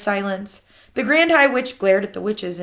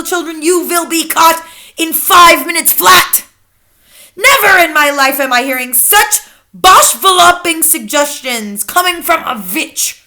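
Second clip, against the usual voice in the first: raging witch; 10 semitones higher, 9 dB louder than usual. A narrator's reading. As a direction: berate the foolish witch. Grand High Witch raging.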